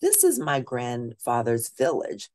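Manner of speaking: ordinary delivery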